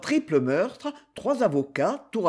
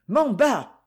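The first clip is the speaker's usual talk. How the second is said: higher, louder call across the house; 7 semitones higher, 4 dB louder than usual. A narrator's reading. Nervous writer calling his servant Mamba, raised call. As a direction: call the servant's name out loud. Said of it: "appela-t-il".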